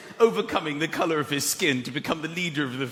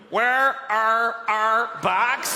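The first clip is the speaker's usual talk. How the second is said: put-on very slow Northern Irish accent; slow comic Northern Irish accent, rising at the end; 3 semitones higher, much slower than usual. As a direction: draw each word out in a Northern Irish accent. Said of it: "get au fait with the accent".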